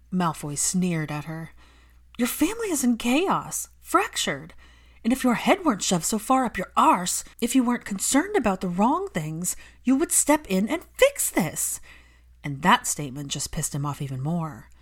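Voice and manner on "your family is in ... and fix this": higher, louder furious plea; angry Hermione. Acted. Hermione scolding Malfoy for neglecting his son, heated. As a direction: defend the lonely child fiercely.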